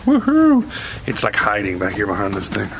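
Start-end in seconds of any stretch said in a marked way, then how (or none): 0.1-0.7 loud high whoop; cheer. Real, not acted. Minister whoops in his youth room, loud high cheer.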